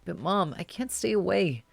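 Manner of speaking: put-on a nervous teen calling mom, holding back tears; anxious young caller. Caller voice continues.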